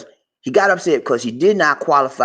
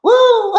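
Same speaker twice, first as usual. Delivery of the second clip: high whooping laugh; host laughing the story off; laughing fit. Real, not acted. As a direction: let out a whoop and cackle.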